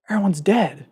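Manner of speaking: high, delighted sing-song; mock-cheerful joy at the end of the world; gleeful delight. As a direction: sound thrilled that everyone's gone. Retold anime hero finding apocalypse.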